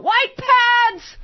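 high loud shout; yell. Retold or imitated. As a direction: yell it out at someone. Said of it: "be sure to yell out".

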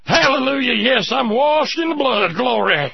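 mock revival preacher. Host mocking fundamentalists with a high, hammed-up preacher voice. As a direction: ham up a tent-revival believer, pitched high. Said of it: "you have to go in and say".

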